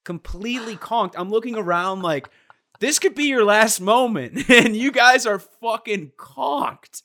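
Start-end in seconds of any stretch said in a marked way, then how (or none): 2.8-7.0 loud, high disbelief at sleeping passengers; acted-out cry of exasperated disbelief; incredulous outburst.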